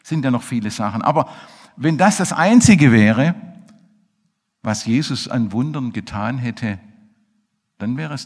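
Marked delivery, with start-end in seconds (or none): 1.0-3.3 emphatic. Real, not acted. Preacher stresses the point.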